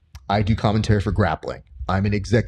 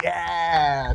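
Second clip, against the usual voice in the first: imitated crowd cheer; 8 semitones higher, 5 dB quieter than usual. Imitating the crowd's delighted answer.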